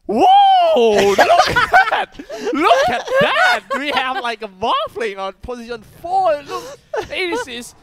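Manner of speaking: high, loud over-the-top excitement; hammed-up hype, shouting at first; hype analyst. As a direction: hype it up like a huge play. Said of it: "has to be as hype as possible".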